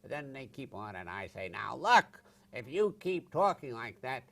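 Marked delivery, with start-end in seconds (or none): 1.5-4.2 loud, high, angry tirade, slowing at the end; shouting, slow, at a heckler; furious retold rant. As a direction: lose your temper at a chattering audience. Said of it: "Now, I'm angry".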